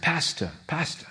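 soft Italian-accented imitation of a church lady; Italian lady greeting her pastor, lightly imitated; Sister Perry. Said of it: "heavy Italian accent".

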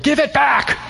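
high-pitched, exasperated command; mock scolding. Telling someone to return undercharged money, mock exasperated. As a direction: order it like a scandalized friend.

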